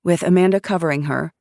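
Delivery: plain reading voice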